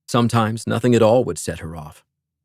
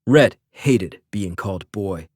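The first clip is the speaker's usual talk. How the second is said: ordinary delivery, nothing marked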